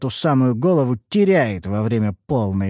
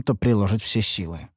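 ordinary delivery, nothing marked

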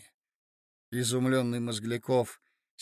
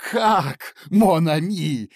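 loud higher outraged cry, slow; furious cry; 3 semitones higher, 9 dB louder, much slower than usual. Acted. Mozglyakov to Marya Alexandrovna, furious outburst. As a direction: explode at the words in fury. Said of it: "вскричал он в бешенстве".

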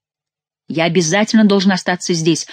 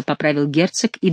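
ordinary delivery

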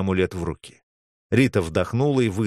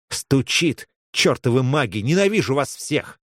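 higher, emotional outburst; overwrought relief; 5 semitones higher than usual. Acted. Girl cursing mages as the heart beats, overwrought relief. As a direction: let the relief burst out as cursing.

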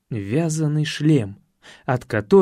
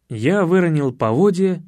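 ordinary delivery

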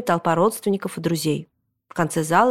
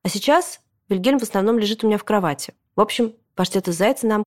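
usual speaking voice

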